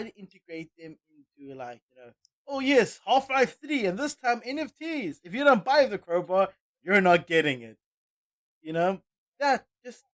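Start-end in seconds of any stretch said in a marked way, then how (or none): 2.5-7.8 loud, high, sarcastic sales pitch; mock hype pitch. Mimicking a game company's hype pitch.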